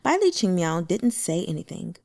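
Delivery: ordinary delivery, nothing marked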